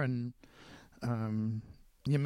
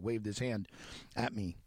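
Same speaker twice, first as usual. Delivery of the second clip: normal talking voice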